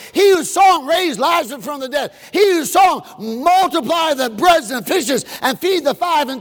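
high, loud, building cry; shouted preaching. Acted. Preacher crying out to the congregation, loud.

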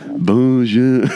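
a cheesy, overdone French "Bonjour"; mock French greeting, hammed up; exaggerated French accent; bad French accent. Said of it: "Oh, that's terrible".

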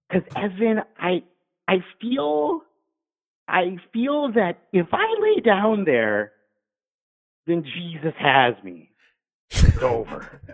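put-on high, slow, drawn-out drunk voice; imitating drunk patient. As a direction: play a drunk woman earnestly stretching out her words. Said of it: "she's just being drunk, saying drunk stuff".

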